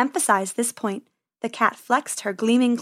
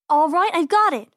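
excited idea; 7 semitones higher, 4 dB louder than usual. Acted. Penny finds a plan.

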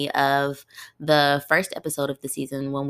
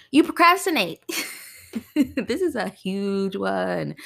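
playful teasing; 4 semitones higher, slower than usual. Real, not acted. Podcast host teasing listening moms about procrastinating, playful high call-out. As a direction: poke fun at the listeners, grinning.

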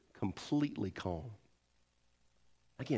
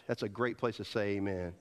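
normal talking voice

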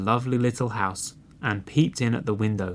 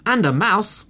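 high, loud squeal of little foxes; storybook scream, pitched way up; excited scream; 11 semitones higher, 6 dB louder than usual. A narrator's reading. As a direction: shriek it out like an eager cub. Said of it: "screamed two of the little foxes".

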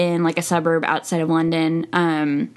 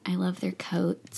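breathy hushed whisper, voice dropped right down; 9 dB quieter than usual. Real, not acted. Friend gushing over the movie's costumes, whispered. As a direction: whisper your love for the coats, just between friends.

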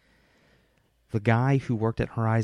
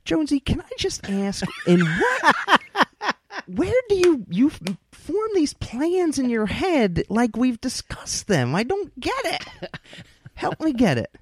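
laughing disbelief. Real, not acted. Incredulous at co-host's plans.

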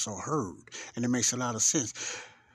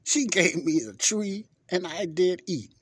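higher, slowed-down excuse to the Lord; Adam making excuses to God, higher and pleading; 5 semitones higher, 4 dB louder, slower than usual. Retold or imitated. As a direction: plead like a caught man passing the blame. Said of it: "Listen to what Adam said".

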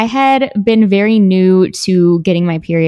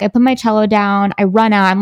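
normal talking voice

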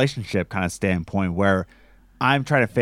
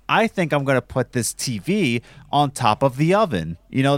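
ordinary delivery

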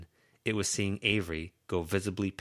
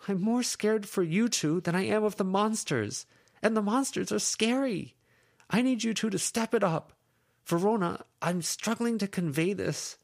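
put-on higher, raised, exasperated young woman; Lucy venting; 4 dB louder than usual. Lucy venting at her friends, higher exasperated voice. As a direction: let it pour out, pleading and fed up.

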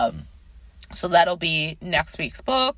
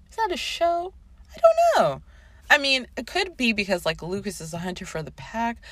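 ordinary talk, nothing marked

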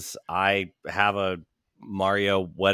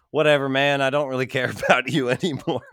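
dismissive brush-off; 5 semitones higher, 3 dB louder, faster than usual. Retold or imitated. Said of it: "I'm just like".

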